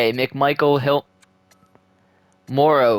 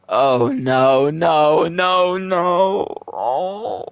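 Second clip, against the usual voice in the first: mock despair; 3 semitones higher, much slower than usual. Facing Seattle in the playoffs.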